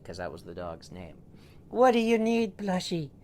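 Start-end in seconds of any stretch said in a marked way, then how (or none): 1.7-3.1 high, loud sing-song; high fussing owner voice; doting family voice. Acted. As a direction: coo at the pet with concern. Family asks the dog again.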